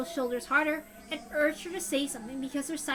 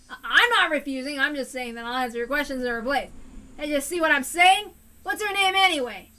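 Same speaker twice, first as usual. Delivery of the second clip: angry yelling; 3 semitones higher, 10 dB louder than usual. Acted. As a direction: bark at the caller to leave you alone. Teppei shouts at caller.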